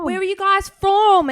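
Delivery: put-on high, chirpy British accent; exaggerated British accent. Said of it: "trying to convince them that I'm from".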